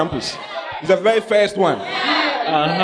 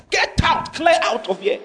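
high mock command to leave; raised, scolding God voice; God ordering out; 8 semitones higher than usual. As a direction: shout the servant out as God. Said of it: "God will say".

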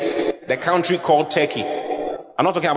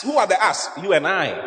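ordinary talk

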